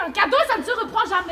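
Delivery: loud, fast retort; heated protest. Acted. Arguing with a companion about the gift taken away, heated protest. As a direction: fire it back in an argument. Acted quarrel over the gift.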